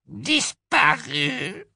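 very high, very slow elf wail; Kreacher, despairing. Acted. Kreacher tells Harry the locket is gone, wailing. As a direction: stretch it out like a broken old elf.

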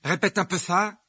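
high, loud, indignant dare; heated boy squaring up for a fight; angry challenge. Acted.

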